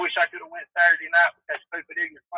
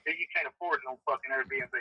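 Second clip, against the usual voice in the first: ordinary talk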